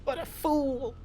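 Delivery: high, gleeful villain crowing; hammed-up greedy cackling voice; gloating villain. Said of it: "And he goes".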